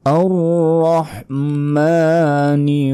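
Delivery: high, slow melodic tilawat; Quranic recitation. Shown as a demonstration. Teacher reciting the verse for learners, high drawn-out chant. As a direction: stretch each vowel in a sung recitation.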